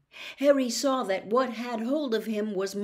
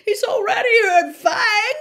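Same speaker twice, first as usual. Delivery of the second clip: much higher, louder squeak of fear; unnaturally high voice; 12 semitones higher, 12 dB louder than usual. A narrator's reading. Ron answering Harry in terror, squeaky high voice. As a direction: voice jumps way up in panic. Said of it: "in an unnaturally high voice".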